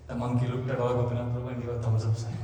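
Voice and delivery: words half laughed; laughing while exaggerating. Real, not acted. Monk laughs at his own tall tale. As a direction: chuckle through the exaggeration. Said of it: "Sometimes I exaggerate".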